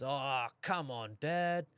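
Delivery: drawn-out mock protest of a little kid; imitating complaining son. Three-year-old son to dad after no fish, imitated complaint. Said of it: "He goes".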